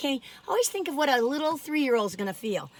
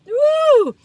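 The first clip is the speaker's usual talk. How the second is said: high, loud woo; gleeful whoop; excited whoop; 9 dB louder than usual. Real, not acted. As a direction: cheer it out. Cheering the last cookie.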